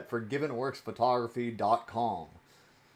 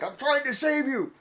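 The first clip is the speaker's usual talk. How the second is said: high, loud, laughing hellfire shout; over-the-top mock threat, cracking up; mock preacher yelling; 11 semitones higher, 9 dB louder than usual. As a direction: ham up a pushy preacher at the fish. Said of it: "and be like".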